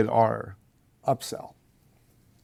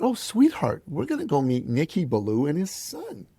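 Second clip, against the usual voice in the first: put-on mother to child; 5 semitones higher than usual. Mother telling her little boy whom they'll meet, lilting mom voice. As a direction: answer sweetly as a mother to a small son.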